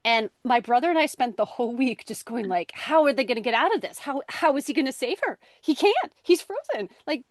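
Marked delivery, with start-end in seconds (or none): 2.7-7.2 anxious excited. Retold or imitated. Kids panicking about cliffhanger.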